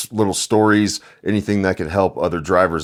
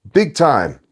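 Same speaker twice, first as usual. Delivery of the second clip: much higher and louder, gleeful put-down; mock verdict; 10 semitones higher, 5 dB louder, much slower than usual. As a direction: slam the answer home. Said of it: "And we can be like".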